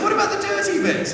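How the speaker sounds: high, incredulous, half-laughing question; teasing disbelief. Retold or imitated. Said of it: "And I said".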